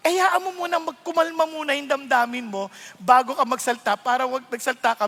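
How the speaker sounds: ordinary talk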